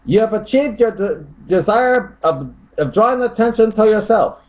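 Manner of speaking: high put-on critic's voice; mock commenter voice. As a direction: read it out high, like a scolding commenter. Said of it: "Tony Stokes says".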